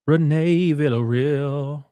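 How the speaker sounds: slow, stretched-out name; drawn-out name. Acted. Thanking donor Renee, slow stretched name. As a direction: savor each syllable.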